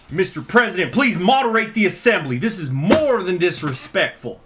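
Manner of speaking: high, loud appeal to the chair; outraged delegate addressing the assembly; indignant protest. Acted. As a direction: protest loudly, pitched well up.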